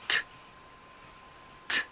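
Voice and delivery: "t" said as a bare voiceless t, no vowel after; isolated t sound. Shown as a demonstration. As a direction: model "t" as a short puff. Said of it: "repitan después de mí".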